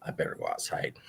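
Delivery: breathy whisper to herself; whispered thought. Retold or imitated. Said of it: "So I'm thinking".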